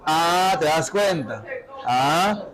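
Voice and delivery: ordinary talk, nothing marked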